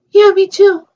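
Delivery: a doll answering eagerly. Pretend. Two toy characters answer in turn.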